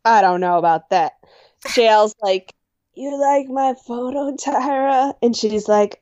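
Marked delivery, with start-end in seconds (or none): 2.9-5.1 J L impression. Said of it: "She's trying to do J L's voice".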